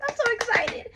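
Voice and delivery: very high, giddy, repetitive gushing; over-excited squealing. Real, not acted. Unboxer can't wait to see the doll, squealing with excitement. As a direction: squeal as if unable to contain it. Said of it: "Anyways, let me stop".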